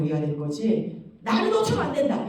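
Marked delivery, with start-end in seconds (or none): 1.2-2.3 smug contempt. Shown as a demonstration. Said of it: "이렇게 하면 안 되는 거예요".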